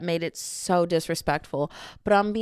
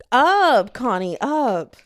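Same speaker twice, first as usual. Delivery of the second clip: loud command to dog; 4 semitones higher, 6 dB louder, much slower than usual. Real, not acted.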